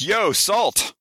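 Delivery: a high, blunt mock demand for the salt. Rude dinner guest demanding salt, mock blunt. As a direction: bark it like a rude diner. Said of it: "Not just".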